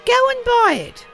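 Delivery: very high, eager call; high-pitched, giddy command; excited order. Acted. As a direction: urge someone off on an errand. Scrooge eagerly orders the boy.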